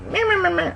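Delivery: high, nasal-free sing-song meh meh meh; mock bleating of a nagging clerk. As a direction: mimic someone nagging back at you. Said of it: "she started, like".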